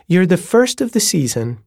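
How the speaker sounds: a bright, high welcome with a rise at the end; lilting landlady. A narrator's reading. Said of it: "the way her voice went up at the end of each sentence".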